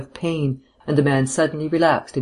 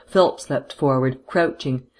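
plain reading voice

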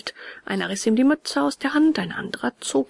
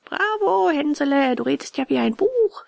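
high, mildly mocking cheer; lightly acted boyish taunt; jeering schoolboy; 6 semitones higher than usual. A narrator's reading. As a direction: call out like a cheeky rascal, without much volume. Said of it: "schrie einer der Schlingel".

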